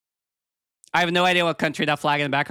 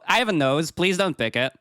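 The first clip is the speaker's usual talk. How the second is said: ordinary talk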